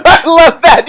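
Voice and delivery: high, laughing reply; laughing delight. Real, not acted. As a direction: say it through real laughter. Amused by viewer's comment.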